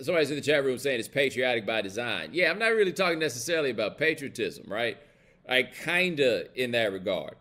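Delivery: normal talking voice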